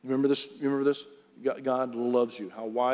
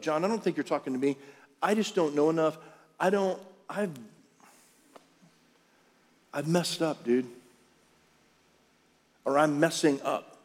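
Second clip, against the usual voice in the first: doubting listener's excuse; 3 semitones higher than usual. Acted. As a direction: let it tumble out, unsure of yourself. Pastor voices a doubting listener.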